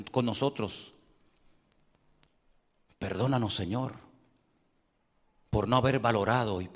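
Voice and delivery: oración suave y grave, despacio; guía a los fieles a pedir perdón; oración guiada. Acted. Leads the congregation's prayer. (oración recogida, voz baja)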